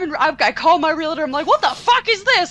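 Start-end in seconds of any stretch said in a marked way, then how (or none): 1.5-2.5 higher indignant outburst; retold rage. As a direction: let the rage crack through, pitched up. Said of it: "I am in full rage mode".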